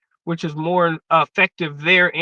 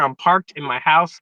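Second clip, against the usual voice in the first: usual speaking voice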